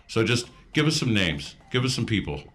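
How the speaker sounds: ordinary talk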